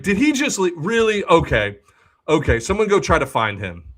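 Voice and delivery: high, loud and frantic; rally the staff in a panic; flustered outburst. Retold or imitated. Bartender making a scene over the runaway, loud alarm.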